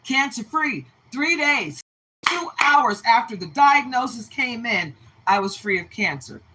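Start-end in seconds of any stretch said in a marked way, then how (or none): none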